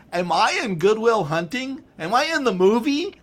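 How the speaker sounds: high, loud incredulous questions; amazed mock disbelief. Visitor marvelling at hearing Boston accents, amazed mock disbelief. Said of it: "when you hear that Boston accent, oh, it's, it, you're going".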